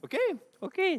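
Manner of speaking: very high, compliant little okays; put-on agreement. Preacher outwardly agreeing with his pastor, high and compliant. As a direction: agree in a high, over-obliging voice.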